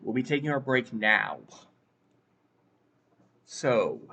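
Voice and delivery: ordinary talk, nothing marked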